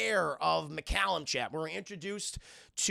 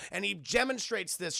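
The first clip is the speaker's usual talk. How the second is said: ordinary talk, nothing marked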